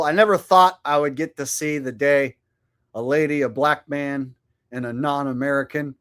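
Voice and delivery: usual speaking voice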